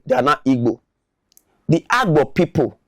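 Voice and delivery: normal talking voice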